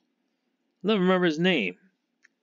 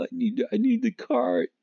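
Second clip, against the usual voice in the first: much higher, whining mock plea; whiny high falsetto mockery; mock whiny player; 12 semitones higher than usual. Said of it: "they're asking".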